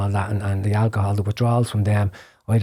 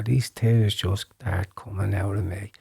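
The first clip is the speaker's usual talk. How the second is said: ordinary delivery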